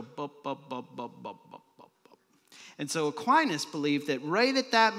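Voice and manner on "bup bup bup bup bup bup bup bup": low bups fading away to almost nothing; winding-down "bup" noises; descending bup sounds. Shown as a demonstration. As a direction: step down bup by bup, softer each time. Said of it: "and then we start to go".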